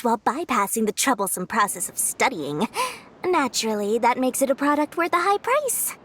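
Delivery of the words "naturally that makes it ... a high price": ordinary talk, nothing marked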